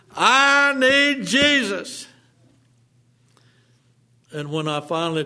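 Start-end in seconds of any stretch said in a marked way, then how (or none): 0.1-2.1 a loud, high, slow cry; desperate shout, high and loud. Acted. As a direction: cry out your need, full force. Climax of desperate pleading cry.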